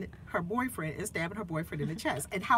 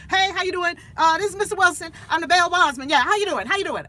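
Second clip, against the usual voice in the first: higher, louder chummy bail bondsman; Mister Wilson's voice; 9 semitones higher, 10 dB louder, faster than usual. Retold or imitated. Bail bondsman greeting the mother over the phone, loud chummy voice. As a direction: play the overfriendly bondsman on the phone, loud and high. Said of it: "here comes Mister Wilson's voice".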